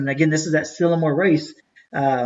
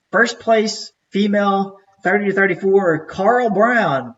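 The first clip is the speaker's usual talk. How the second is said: announcer voice; 4 semitones higher, slower than usual. Retold or imitated. Race director calling out age group winners over the crowd, mock announcer voice. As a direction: call it out like the emcee at the podium.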